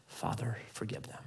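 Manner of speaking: very soft, low plea; hushed prayer. Acted. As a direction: pray it tenderly, barely aloud. Jesus's prayer from cross, voiced.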